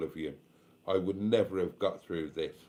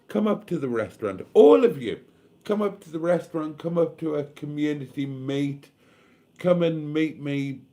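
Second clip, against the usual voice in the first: eager invitation; 6 semitones higher, 5 dB louder than usual. Real, not acted. Inviting viewers to meet.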